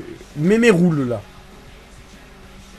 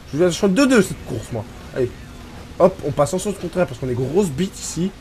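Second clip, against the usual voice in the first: usual speaking voice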